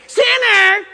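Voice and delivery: high, loud laughing yell; hammed-up judge shout; yelled sinner. Shown as a demonstration. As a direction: bellow it like a harsh judge. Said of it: "He probably didn't say".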